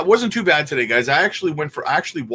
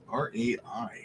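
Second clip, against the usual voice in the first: breathy secret whisper; 3 semitones lower, 10 dB quieter than usual. Acted. Whispering the ticker name.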